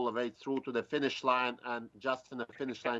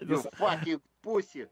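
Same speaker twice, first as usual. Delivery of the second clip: higher-pitched mock Russian gangster insult; Russian gangster accent; 6 semitones higher, 3 dB louder than usual. Shown as a demonstration. Driver capping his gangster act for laughing hosts, Russian accent. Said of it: "a real Russian gangster".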